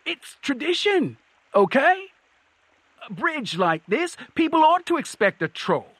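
high, pleading troll protest; desperate troll. A narrator's reading. Troll explaining itself at knifepoint, high and desperate. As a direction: plead your case in a high voice. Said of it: "it said desperately".

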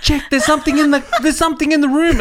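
retelling his wife's panic, hammed-up; imitating a panicked wife. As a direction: rush it like she's scared. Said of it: "And Emma's screaming at me".

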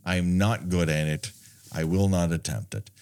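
ordinary delivery

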